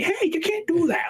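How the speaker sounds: very high, indignant mock scold; mock shrill old lady. Mimicking the old lady scolding them, very high.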